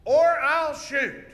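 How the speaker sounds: slow, high shouted warning; hero's shouted threat. Shown as a demonstration. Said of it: "Watch here. It goes".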